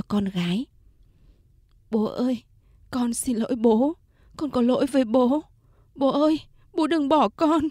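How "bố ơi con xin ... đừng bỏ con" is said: tearful plea. Acted. Daughter pleads at father's deathbed.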